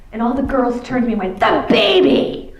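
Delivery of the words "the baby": higher, louder, drawn-out shout; class shouting back. Retold or imitated. Tough schoolgirls answering the nun, slow loud shout. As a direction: blurt it out as a crowd.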